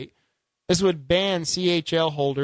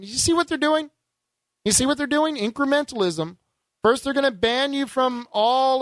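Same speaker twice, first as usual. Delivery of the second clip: worked up; 9 semitones higher, 3 dB louder than usual. Real, not acted. Host rails against new gun bill.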